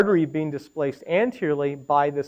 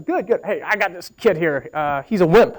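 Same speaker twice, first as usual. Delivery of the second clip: head of ENT. Retold or imitated. Head of ENT grumbling to radiologist about a young patient, imitated voice. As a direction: mimic a colleague's way of talking. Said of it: "You should talk like this".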